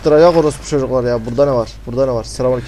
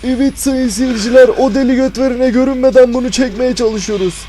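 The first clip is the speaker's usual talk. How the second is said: higher-pitched mock TV reporter commentary; mock live announcer; 7 semitones higher than usual. Gamer addressing dear viewers while fleeing the monster, mock announcer commentary. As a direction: report the chase to the viewers like a broadcaster.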